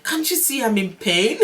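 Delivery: amused, indignant rising question; mock exasperation. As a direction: complain in pain, half amused. Retold outburst at husband, laughing after.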